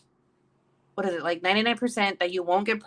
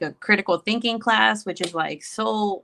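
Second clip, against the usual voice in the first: usual speaking voice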